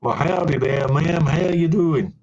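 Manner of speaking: hammed-up American howdy, rising in pitch; put-on American greeting, lifting at the end; attempted American accent. Shown as a demonstration. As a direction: lay on a folksy American accent. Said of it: "let's try this American accent".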